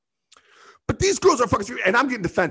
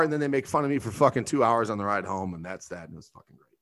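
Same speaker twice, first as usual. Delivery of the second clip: usual speaking voice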